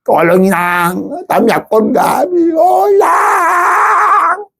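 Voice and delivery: high, shouted plea in a put-on accent; pleading sick person. Sick churchgoer cries to the pastor for help: high shouted accented plea. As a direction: cry out for help like a desperate foreigner.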